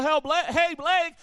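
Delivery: demonstrating a yell for someone; calling out. Shown as a demonstration. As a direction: holler his name to turn his head. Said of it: "If I yell".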